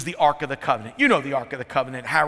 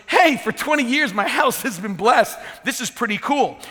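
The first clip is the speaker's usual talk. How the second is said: higher-pitched, pleased surprise, acting out a quote; pleasantly surprised; 6 semitones higher than usual. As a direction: marvel at an unexpected blessing, pitched up. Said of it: "he's surprised. He goes".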